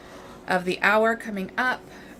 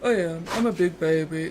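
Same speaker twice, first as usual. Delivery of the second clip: voicing the dog; 2 semitones lower, 3 dB louder than usual. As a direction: play the dog boasting he's a big baby.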